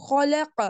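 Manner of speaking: خلق as "khalaqa", heavy kh and throaty q; slow خلق with both kh and q full; full kha and qaf. Shown as a demonstration. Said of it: "اب خا اس کو بھی پر پڑھنا ہے".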